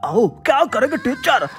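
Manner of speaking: usual speaking voice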